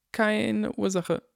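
high, slow reflexive answer; automatic reply. A narrator's reading. Harry answering the crying woman, slow and automatic. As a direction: say it on autopilot. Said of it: "sagte Harry vollkommen automatisch".

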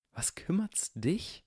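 whispered, high and slow; a slow whispered jab; hissed whisper. A narrator's reading. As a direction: breathe it out as a sneering whisper. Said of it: "sagte Draco".

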